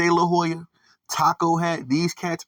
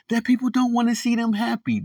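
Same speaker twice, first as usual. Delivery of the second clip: much higher-pitched mocking retelling; mock complaint; 10 semitones higher than usual. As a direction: relay their complaint high and incredulous. Retelling the pair's complaint in mockery.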